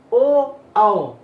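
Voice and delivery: loud, rounded ओ and औ with lips pushed; rounded lip vowels. Shown as a demonstration. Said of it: "देखो ओंठ पे भी जोर दिए".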